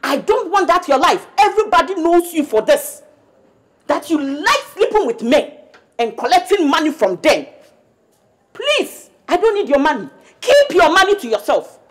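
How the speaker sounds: loud, furious rant; furious mother rejecting daughter's money; angry scolding. Acted. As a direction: drive her away.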